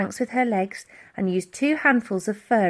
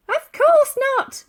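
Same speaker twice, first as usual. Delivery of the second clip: put-on old lady's voice; 7 dB louder, slower than usual. A narrator's reading. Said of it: "replied Dotty".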